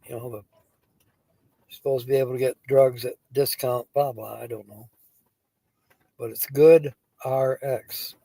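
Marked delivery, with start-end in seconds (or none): none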